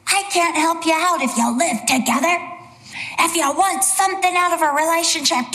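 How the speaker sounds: exasperated mock telling-off; Judge Judy. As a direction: lecture the hapless couple from the bench. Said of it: "she talks like that".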